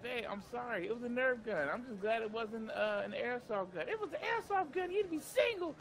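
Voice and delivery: pleading. Retold or imitated. Pleading with angry girlfriend.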